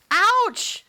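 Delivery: sharp high yelp of pain; shout. Acted. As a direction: yelp it out loud. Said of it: "shouted Toad".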